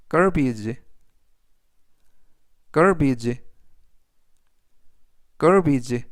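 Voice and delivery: teacher modelling "garbage" for students to repeat; careful "garbage", first syllable stressed; model pronunciation. Shown as a demonstration. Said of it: "라고 발음하시면 되겠고요".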